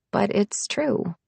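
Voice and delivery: ordinary delivery, nothing marked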